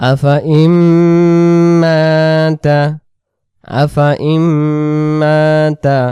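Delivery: slow melodic chant, words drawn out; Quran recitation. Shown as a demonstration. Teacher chanting the verse for students to copy. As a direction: recite it slowly and tunefully.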